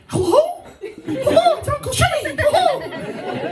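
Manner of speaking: very high Mickey Mouse squeak; high, cartoonish child on the phone; squeaky kid voice. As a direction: imitate an excited little nephew, way up high. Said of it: "You kinda sound like Mickey Mouse".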